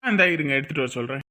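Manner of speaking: ordinary talk, nothing marked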